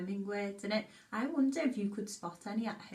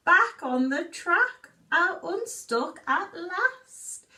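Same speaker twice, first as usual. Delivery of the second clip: put-on reading a story to children; 4 semitones higher, 5 dB louder than usual. Reads picture book aloud.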